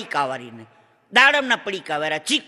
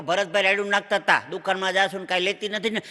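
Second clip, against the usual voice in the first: ordinary talk, nothing marked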